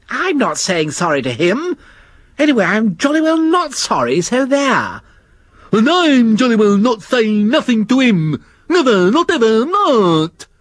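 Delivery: put-on high, stubborn childish refusal; stubborn sulky creature. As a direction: dig your heels in like a child.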